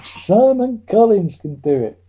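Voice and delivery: loud, high, slow repeat for effect; emphatic proclamation. Real, not acted. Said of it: "I'm gonna say it one more time".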